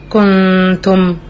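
كنتم said very slowly with a nasal hum on the noon; correct ghunna. Shown as a demonstration. Teacher showing the correct ghunna, slow and nasal. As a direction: hold the ghunna in كنتم and keep the tongue still. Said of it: "لما بقول كلمة".

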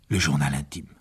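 ordinary delivery

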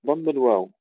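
normal talking voice

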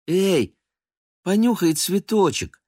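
put-on bright little girl pushing a vase forward. A narrator's reading. Polya offering the cat a flower, high childish voice.

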